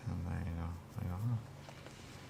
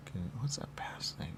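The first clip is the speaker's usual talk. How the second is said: whisper; 7 semitones lower, 13 dB quieter than usual. Real, not acted. Muttering to self in session.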